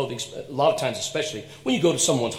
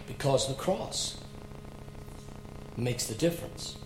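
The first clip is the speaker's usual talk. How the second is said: hushed sermon point; 10 dB quieter than usual. Acted. Preacher's slow point.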